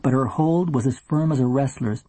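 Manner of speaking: usual speaking voice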